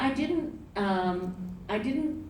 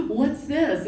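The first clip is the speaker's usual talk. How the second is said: higher and louder, a sudden excited question; excited surprise; 8 semitones higher, 5 dB louder than usual. Retold or imitated. Jumping from the bath at the dialect coach, excited. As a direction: leap up at a discovery, thrilled. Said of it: "jumped out of the bathtub and was like".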